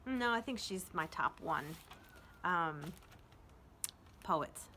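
ordinary talk

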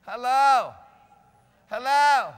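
loud pulpit "hello" to rouse listeners; calling out. Real, not acted. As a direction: belt it out to wake up the room. Calls out to unresponsive congregation.

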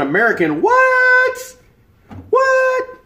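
very high, loud screech; mock shocked shout. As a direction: shriek it in mock disbelief. Mock outburst at a chat message.

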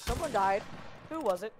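loud call across the room; calling from far away. Real, not acted. Calling out to teammates.

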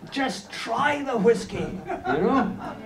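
high, slow, laughing plea; pleading bartender trainer. Retold or imitated. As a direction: beg them to try it, half laughing. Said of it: "chasing them down the road going".

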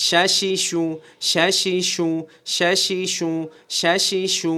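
স্যা সি সু with a hushing sh on each syllable; "sha" sound. Shown as a demonstration. Teacher drilling the letter shin, hushing sh sound. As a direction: say স্যা সি সু as sha-shi-shu. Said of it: "শাসা আওয়াজ হবে".

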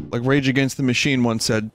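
ordinary delivery

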